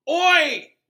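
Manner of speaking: sudden loud roar of protest. A narrator's reading. Ron roaring as the cat attacks his bag, loud. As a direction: bellow it out at the cat.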